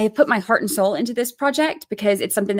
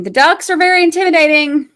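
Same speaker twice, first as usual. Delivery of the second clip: mock-scared, higher and louder, stress on very; 8 semitones higher, 7 dB louder than usual. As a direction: ham up being scared of the ducks.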